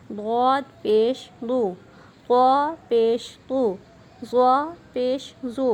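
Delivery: slow, clear syllable-by-syllable reading; recitation drill. Shown as a demonstration. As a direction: read each letter slowly like a teacher. (آہستہ واضح ہجے)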